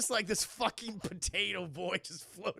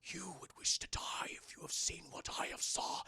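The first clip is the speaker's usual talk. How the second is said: soft, breathy possessed whisper; voiceless, ominous hushed delivery; eerie whisper; 5 dB quieter than usual. Acted. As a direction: whisper it like a haunted man. Possessed crewman's lines acted.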